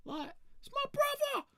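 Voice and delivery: extremely high, squealed protest; squealing mock panic. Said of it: "I was like".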